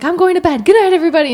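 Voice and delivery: high, loud call to everyone; loud good night. Retold or imitated. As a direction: announce bedtime loudly to the house. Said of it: "So he was like".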